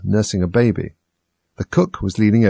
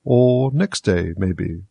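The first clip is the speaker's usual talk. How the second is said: very slow, unbothered drawl; same unmoved tone; much slower than usual. Acted. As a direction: say it slowly, as if nothing happened. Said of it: "in the same tone".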